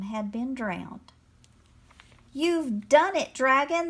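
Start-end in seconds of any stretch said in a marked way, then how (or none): 2.3-3.9 high, loud, triumphant shout. A narrator's reading. Mole cheers the dragon's success, shouting. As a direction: shout the victory, drawing it out. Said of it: "the mole shouted".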